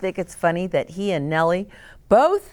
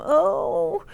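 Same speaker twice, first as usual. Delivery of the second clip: higher, fretting costume-maker voice; worried Brock; 12 semitones higher than usual. Retold or imitated. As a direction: pitch up and fret over the costume. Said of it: "he was worried about his costume".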